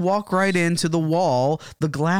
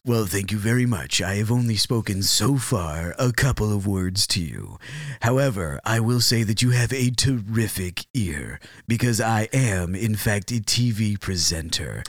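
lower, measured presenter voice; silky-smooth broadcaster, pitched down; 5 semitones lower than usual. Acted. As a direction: play a self-assured TV presenter with a silky voice. Plays TV presenter Steven Stargazer.

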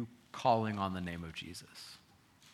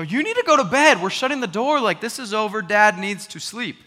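much higher, loud fed-up yell; exasperated dad; 13 semitones higher, 19 dB louder than usual. Retold or imitated. Father retelling yelling at his son to go to bed, loud and exasperated. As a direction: shout like a worn-out father at his limit.

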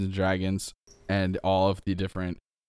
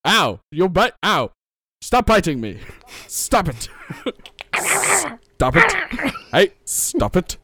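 higher, louder yelps of playful pain; mock pain; 10 semitones higher, 3 dB louder than usual. Stable man bitten by the goblin, mock yelping. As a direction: yelp while being bitten, laughing.

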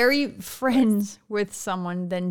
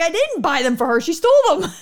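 mock defensive; 6 semitones higher, 7 dB louder than usual. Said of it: "I'm like".

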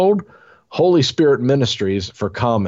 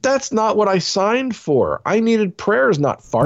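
higher, indignant woman voice; complaining woman; 8 semitones higher than usual. Acted. Voicing the forty-year-old woman, higher and indignant. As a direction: whine it like the fed-up churchgoer.

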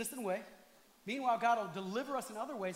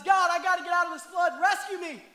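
loud desperate plea for rescue; crying out to God; 6 semitones higher, 8 dB louder than usual. Retold or imitated. Trapped man crying out to God from his flooded house.

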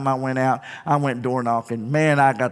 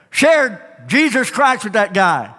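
ordinary delivery

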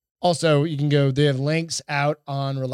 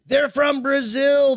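excited shout; 9 semitones higher, 4 dB louder, slower than usual. Real, not acted. Excited outburst about fighters.

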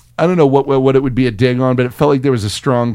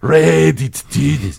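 put-on German accent. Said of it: "my quick German accent".

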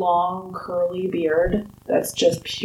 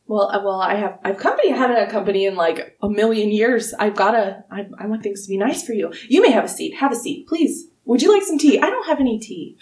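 a raised, hurried gnome voice fussing over guests; an eager old host flustered by company, pitched up; fussy old gnome host; faster than usual. Acted. GM holds Wulfric voice fussing over guests.

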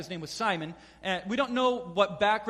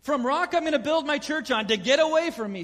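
loud, high sharp rebuke; mock stern outburst; 5 semitones higher, 5 dB louder than usual. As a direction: hammer the turn from praise to scorn. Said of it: "That's a quick turn".

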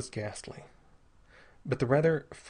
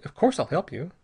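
anxious; 6 semitones higher, 5 dB louder than usual. A narrator's reading. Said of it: "he demanded anxiously".

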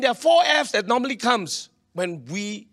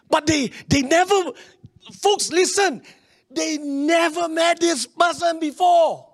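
preaching shout; 8 semitones higher, slower than usual. Real, not acted. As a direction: drive the point home to the folks. Preacher building to emphasis.

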